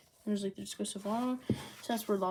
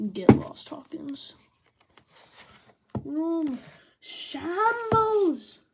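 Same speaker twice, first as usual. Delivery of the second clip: loud, higher attack call building to a top-volume shout; hammed-up battle shout; 7 semitones higher, 8 dB louder, much slower than usual. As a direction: call out the attack move like an anime hero. Law battle cry in recap.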